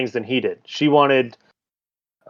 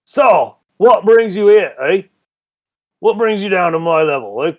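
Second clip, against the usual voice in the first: a lightly voiced Drider, mildly louder and higher, ending on eh; a folksy underworld creature sizing up visitors; gruff Drider host; 6 semitones higher, 5 dB louder than usual.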